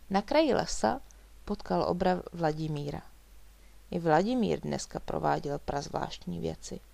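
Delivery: ordinary delivery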